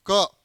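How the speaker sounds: "क" punched out short and loud; stress on क. Shown as a demonstration. Teacher showing extra stress on a letter, "क" said forcefully. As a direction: hit "क" with sudden force. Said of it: "जब ज्यादा जोर दूंगा".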